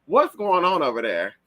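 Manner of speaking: ordinary talk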